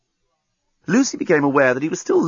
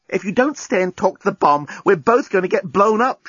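higher-pitched exasperated insistence; urgent warning; 9 semitones higher than usual. Acted. Lucy warning of the bomb, urgent. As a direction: warn with pitch raised.

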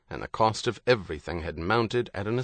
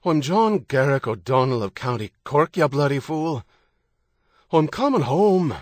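angry Irish voice, raised high; angry shout; 5 semitones higher, 4 dB louder than usual. A narrator's reading. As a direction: let the anger out, loud and Irish. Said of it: "He let the anger come out in his voice".

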